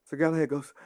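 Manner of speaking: usual speaking voice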